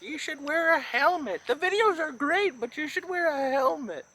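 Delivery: high-pitched mock mom voice; nagging mother. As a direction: scold like a worried mother. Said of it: "Make our mothers proud".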